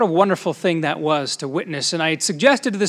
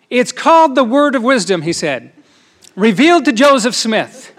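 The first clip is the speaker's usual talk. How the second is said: eager explanation; 6 semitones higher, 7 dB louder than usual. Retold or imitated. Les proudly explains church teaching.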